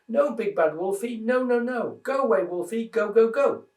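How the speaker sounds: high, rising frightened squeal; frightened piglet crying out at the wolf; terrified squeal. Acted. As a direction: squeal it out in panic like a tiny pig. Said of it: "in terror he squealed".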